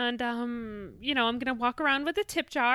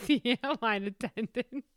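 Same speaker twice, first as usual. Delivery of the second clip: ordinary talk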